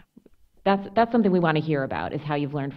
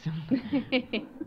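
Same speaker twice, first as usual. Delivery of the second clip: soft laugh; 6 semitones higher, 5 dB quieter than usual. Real, not acted. Laughing at her own advice.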